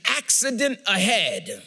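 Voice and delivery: slow, spaced-out navigation warning; GPS alert voice. Acted. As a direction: announce it like a satnav alert. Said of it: "it will tell you".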